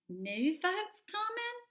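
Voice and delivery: eager high squirrel question; high, slow, eager question; quick eager question. A narrator's reading. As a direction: jump at the news, high and eager. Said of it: "inquired the squirrel quickly".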